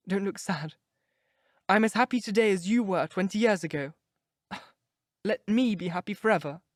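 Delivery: put-on a lovestruck girl pouring her heart out; young girl in love. A narrator's reading. Sybil comforting mother.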